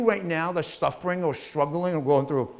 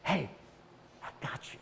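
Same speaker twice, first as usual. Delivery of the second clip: breathy whisper of a voice in your head; 15 dB quieter than usual. Retold or imitated. An inner voice reassures a listener, whispered. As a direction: breathe I got you as a secret whisper. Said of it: "you hear this voice".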